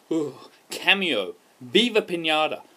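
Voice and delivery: ordinary talk, nothing marked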